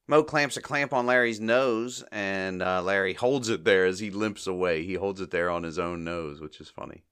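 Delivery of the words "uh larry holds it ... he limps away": ordinary talk, nothing marked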